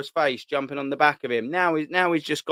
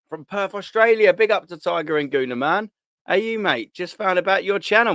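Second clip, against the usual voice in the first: excited shout-out; 6 semitones higher than usual. Real, not acted. Excited shout-out to Australian viewers.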